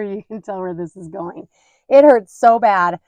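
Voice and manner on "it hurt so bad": It hurt so bad stretched out loud and slow, hurt leaned on; emphatic pain. Mom stressing her strep pain, loud and slow. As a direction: groan the word hurt out loud.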